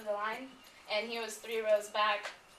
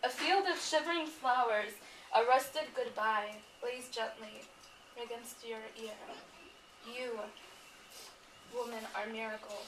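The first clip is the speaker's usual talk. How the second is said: poem reading. Acted. Reading a poem to Ahmed's mother, tender and measured.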